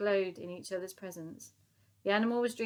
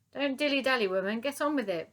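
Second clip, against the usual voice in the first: higher, louder bellow; 6 semitones higher, 5 dB louder than usual. A narrator's reading. Sergeant major to the cleaning lady, bellowing orders. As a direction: bellow him into action. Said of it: "bellowed the sergeant major".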